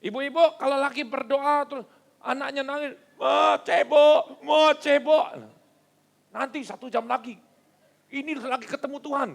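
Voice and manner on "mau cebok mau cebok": put-on loud, high, slow child's wail; mock crying child; whiny child. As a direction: wail like a small child who needs help.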